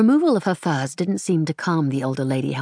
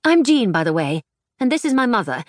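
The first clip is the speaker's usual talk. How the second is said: put-on higher, lively young voice; young woman; 7 semitones higher, 4 dB louder than usual. A narrator's reading. Jean introducing herself to Paddy in a higher lively voice.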